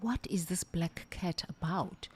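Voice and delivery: unvoiced whisper, hushed; a retold whisper to herself. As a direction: breathe it to yourself in a hush. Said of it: "And I said".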